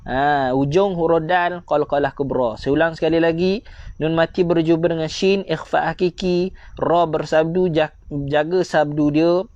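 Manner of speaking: normal talking voice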